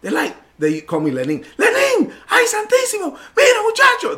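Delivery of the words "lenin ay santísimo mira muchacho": high-pitched frantic yell from behind; friends yelling. Retold or imitated. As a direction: shout a warning in Spanish as he speeds downhill. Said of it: "my friends are yelling out behind me".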